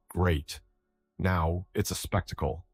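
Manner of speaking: usual speaking voice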